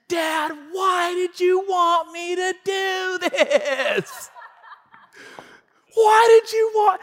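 put-on high, drawn-out child's wail; mad, tearful daughter. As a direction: wail at dad like a betrayed kid.

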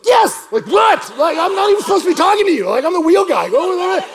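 high, loud, quickened protest; hammed-up exasperated retort; flustered shouting. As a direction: yell it in comic panic. Comic panicked retort.

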